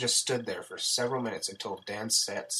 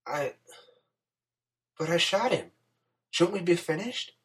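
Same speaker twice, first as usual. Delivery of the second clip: higher-pitched, hesitant plea; pleading; 8 semitones higher, 3 dB louder than usual. A narrator's reading. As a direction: plead like a reluctant woman, voice rising. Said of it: "Sarah pleaded".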